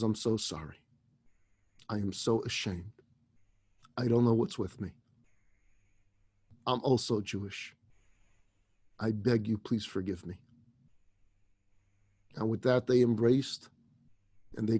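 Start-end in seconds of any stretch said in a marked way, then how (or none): 0.0-10.4 low, hushed, halting plea; remorseful apology. Acted. Employee begging the praying woman's forgiveness, remorseful. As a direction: apologize in a lowered voice, pausing between phrases.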